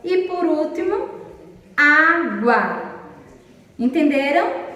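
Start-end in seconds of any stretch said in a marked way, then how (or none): 1.8-2.9 "água" as á... gua, two separated beats; split into two syllables. Shown as a demonstration. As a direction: break "água" slowly into á-gua, first beat stronger. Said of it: "Dissílabas são quando há duas sílabas".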